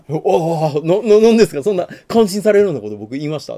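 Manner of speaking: stammering, loud and high; flustered surprise. Retold or imitated. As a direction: caught off guard, flustered. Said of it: "って言ったら".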